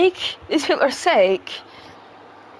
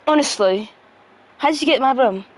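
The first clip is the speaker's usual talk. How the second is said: normal talking voice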